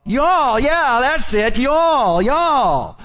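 "Y'all" high and ringing, one syllable; southern y'all. Retold or imitated. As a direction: say "y'all" like a southern Indiana wife. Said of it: "she'd say".

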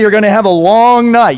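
loud, high and fast coach threat; coach's warning. Retold or imitated. Coach threatening his lineman, loud fast warning. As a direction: snap it out like an angry coach. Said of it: "I'm gonna tell you".

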